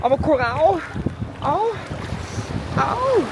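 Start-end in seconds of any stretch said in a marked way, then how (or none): 1.4-3.2 ouch. Real, not acted. Stepping on coral.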